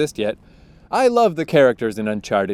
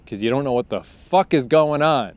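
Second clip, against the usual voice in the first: ordinary talk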